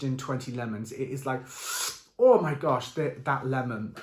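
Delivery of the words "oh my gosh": a sudden louder gasp of amazement; surprised exclamation. Real, not acted. Describing the lemon teeth, suddenly louder in amazement. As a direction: blurt it out, struck by how sharp it is.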